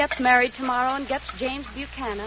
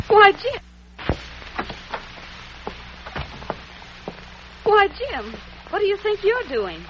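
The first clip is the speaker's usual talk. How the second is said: astonished outburst; surprised; 7 semitones higher than usual. Acted. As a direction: caught off guard by what he's doing. Finds him washing dishes.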